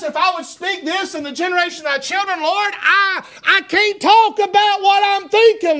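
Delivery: high-pitched, full-force pulpit delivery; impassioned preaching. Acted. Preacher voices the troubled psalmist pleading with the Lord, impassioned.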